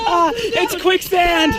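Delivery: slow, high shouted alarm. Real, not acted. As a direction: shout it out, half laughing, half alarmed. Sinking in the mud.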